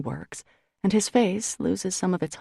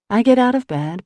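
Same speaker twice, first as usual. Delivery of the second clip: usual speaking voice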